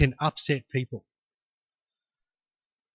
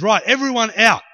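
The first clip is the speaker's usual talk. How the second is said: higher, louder, no-nonsense command; bossy order; 5 semitones higher, 6 dB louder than usual. Acted. Jesus to a crowded house, loud bossy order. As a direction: take charge and clear the room.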